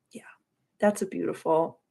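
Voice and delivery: ordinary talk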